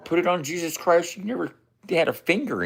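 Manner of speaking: high, teasing repeat; mock high. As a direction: throw it back at him in a high mock voice. Repeating the line, mocking.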